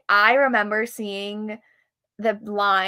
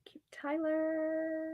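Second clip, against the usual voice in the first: whisper; 6 semitones higher, 11 dB quieter, much slower than usual. Real, not acted. Reading name off list.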